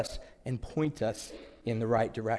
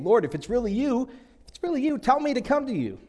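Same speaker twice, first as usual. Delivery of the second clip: much higher, rising urgent plea; pleading Peter; 9 semitones higher than usual. Acted. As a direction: beg to be sure it is really him. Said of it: "Peter says".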